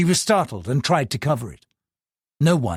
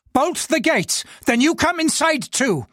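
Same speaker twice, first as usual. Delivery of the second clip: higher, sharp angry orders; angry snap; 13 semitones higher than usual. Acted. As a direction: bark the orders at the driver. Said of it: "he snapped to his equally nervous chauffeur".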